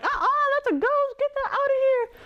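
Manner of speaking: high, frightened cry of "ghost". Retold or imitated. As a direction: shriek it out in sudden fright. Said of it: "they were crying out in fear, like".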